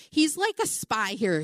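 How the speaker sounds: ordinary talk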